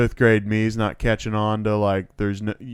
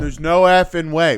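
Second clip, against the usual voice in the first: put-on raised, loud kid's protest; retold insistence; 6 semitones higher, 6 dB louder than usual. Kid arguing with Brian, loud insistent protest. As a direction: run the words together as an exasperated objection.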